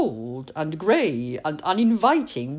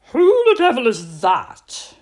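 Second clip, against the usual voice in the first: put-on louder, grumpy old man calling out; gruff shout; 5 semitones higher, 9 dB louder than usual. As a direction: bark it from inside. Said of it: "shouted a voice from inside".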